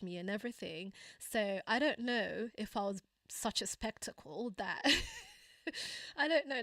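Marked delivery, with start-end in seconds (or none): 4.8-6.6 laughing. Real, not acted. Laughing at being a spectacle.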